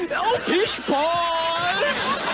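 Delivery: drawn-out, laughing pish posh; mock modest dismissal. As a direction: wave off the praise grandly. Dismissing a compliment in role-play.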